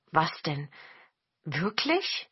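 mild surprise, lifting on the last word; astonished. A narrator's reading. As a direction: ask it as if you can hardly believe it. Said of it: "sagte Herr Kim erstaunt".

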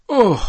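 a sharp high loud anguished Oh; pained high wail. Acted. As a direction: cry out Oh in dismay.

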